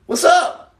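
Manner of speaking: a high, loud shout-out in a put-on voice; loud high greeting. Real, not acted. Streamer greets the chat in a loud, high put-on voice. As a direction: blurt out a high squealing hello. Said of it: "gotta be quiet. Can't say that real loud".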